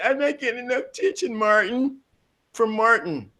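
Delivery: high, soft complaining-wife voice; mock complaining wife. Host mimics a wife complaining to her husband, mock complaint.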